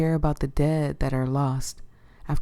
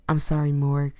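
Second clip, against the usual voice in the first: ordinary delivery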